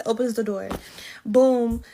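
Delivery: ordinary talk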